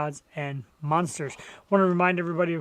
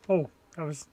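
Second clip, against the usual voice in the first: normal talking voice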